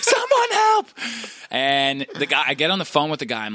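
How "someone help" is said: very high, loud wail for help; hammed-up desperate squeal; mock panic. Acts panicked plea in group chat.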